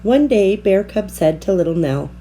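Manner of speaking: plain reading voice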